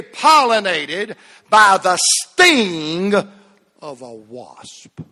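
loud, slow building sermon climax; full-force preaching. Acted. Preacher driving the point home to the church, loud and slow. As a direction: drive it home to the congregation.

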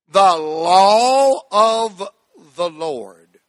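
"The law of the Lord" stretched very slowly, "law" held. Shown as a demonstration. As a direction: draw out "law" long and slow. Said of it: "slowly now".

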